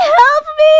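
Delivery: high, loud tearful plea; crying plea. Retold or imitated. Hime pleading to Sudachi through tears.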